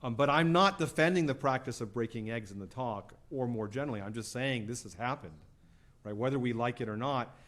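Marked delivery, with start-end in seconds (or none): none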